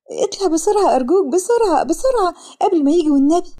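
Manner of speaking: high, urgent frightened plea; panicked urging. A narrator's reading. Fleeing woman begging the driver to speed off, panicked.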